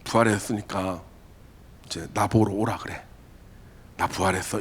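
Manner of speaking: a quiet, casual aside; soft casual announcement. Retold or imitated. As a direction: say it low, almost to yourself. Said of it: "내가".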